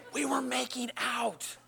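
slow, soft aside; hushed confession. Real, not acted. Hushed confession, children told to cover ears.

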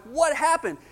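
high, loud, eager burst of questions; retelling his own breathless curiosity; eager excited questions. Retold or imitated. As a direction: pounce on her for the news. Friend returns, he is eager.

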